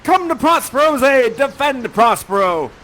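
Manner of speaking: loud, slow and grand; heroic challenge. Acted. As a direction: call him out to fight. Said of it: "He says".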